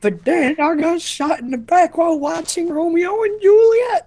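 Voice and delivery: put-on high old man voice; quavering old-timer telling his death; Abraham Lincoln. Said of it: "I'm Abraham Lincoln".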